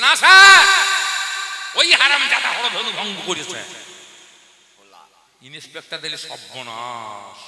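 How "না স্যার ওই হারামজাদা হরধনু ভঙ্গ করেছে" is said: much higher, louder angry cry; teacher angrily blaming the boy; angry outburst. Retold or imitated. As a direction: explode at the inspector in rage. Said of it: "master রেগে গিয়ে বলছে".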